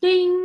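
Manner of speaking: high, loud bell ding; bright timer chime. Retold or imitated. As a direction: ring it out like an oven timer. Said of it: "she said".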